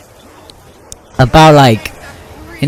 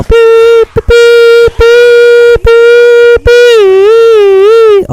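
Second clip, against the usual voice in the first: very high, loud electronic beeps; alarm beeping; 7 dB louder, much slower than usual. Retold or imitated. Kid imitating an emergency alert, loud high beeps. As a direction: squeal out a piercing alarm tone. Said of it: "And you go".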